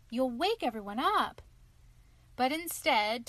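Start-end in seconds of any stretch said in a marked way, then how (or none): none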